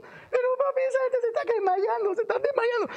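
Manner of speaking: put-on very high-pitched little kid voice; put-on child's falsetto. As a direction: squeak like an alarmed child calling to papi.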